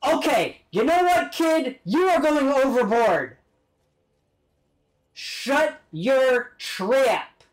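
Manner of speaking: high, loud telling-off, slowing to separate words; exasperated scolding. Real, not acted. Streamer telling off a kid, high exasperated scolding. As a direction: scold sharply, then space out the last three words.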